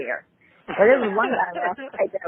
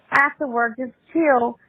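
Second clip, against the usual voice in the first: ordinary delivery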